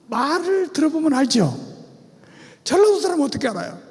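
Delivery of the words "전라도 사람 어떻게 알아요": ordinary delivery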